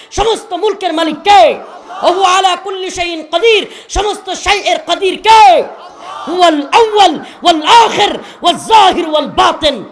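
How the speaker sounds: full-force Bengali waz preaching; fiery sermon. Real, not acted. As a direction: rouse the crowd with booming verses. Fiery preaching with Quranic recitation.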